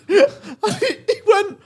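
ordinary delivery

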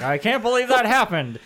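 high, loud fake shock; overacted villain innocence; mustache twirling villain. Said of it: "Like, evil villain. He's twirling his mustache".

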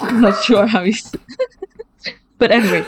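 talking through laughter; laughing. Real, not acted. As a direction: laugh while you speak. Laughing at own joke.

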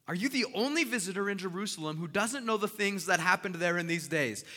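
loud, high, incredulous challenge; sarcastic. Acted. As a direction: throw it at a clueless stranger in disbelief. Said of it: "listen to the, the sarcasm".

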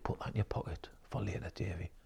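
hushed unvoiced whisper; quick conspiratorial whisper. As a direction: whisper it like a secret tip. Retold guide whispering to him.

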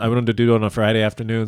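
ordinary talk

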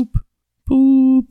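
high, loud mock notification beep; imitated notification boop. Shown as a demonstration. Asking co-host if he hears the alert, mock boop. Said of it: "Are you hearing".

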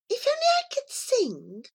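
a cat wishing she could sing; cat's longing thought. Acted. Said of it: "thought Mascori".

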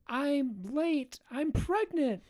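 put-on very high, small young woman's voice; high girly voice. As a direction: squeak out the big news. Cashier voiced in retold story.

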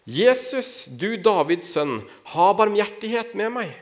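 high, drawn-out plea without full volume; calling out. A narrator's reading. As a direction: read it as a blind beggar calling for mercy. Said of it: "satte han i å rope". (ropende bønn, lyst og langtrukkent)

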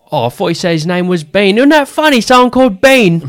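loud, high-pitched mock outburst; excited mimicry. Star tickled by a fan's odd name, loud high mimicry. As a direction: imitate him hooting over a silly name. Retelling star's amused tangent about name.

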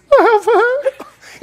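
high, slow, half-laughing half-crying; tearful, choked-up declaration; emotional. Shown as a demonstration. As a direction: let your voice break on it. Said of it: "It's not ... It's".

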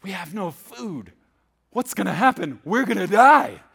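mock panic, building to a loud cry; freaking out. Preacher voicing hungry Israelites freaking out, mock panic. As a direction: work up from complaint to hammed-up alarm. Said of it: "the Israelites are all freaking out".

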